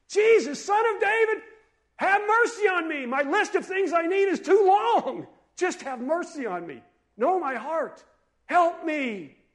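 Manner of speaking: desperate high, loud plea; full-force imploring shout, easing slightly; yelling. Acted. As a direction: cry out from the bottom of the pit. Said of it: "that's what he was yelling".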